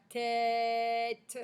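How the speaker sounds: TÊTE held long and loud on ê; elongated vowel. Shown as a demonstration. Teacher lets pupils hear è, "tête" drawn out. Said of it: "c'est bien le son est".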